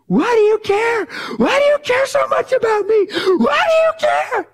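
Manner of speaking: very high, loud frantic shout; freaked-out scream. Retold or imitated. Woman panicking at the preacher, high shrieking outburst. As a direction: scream the question as if she can't bear being cared for. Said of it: "I'm talking freaked out".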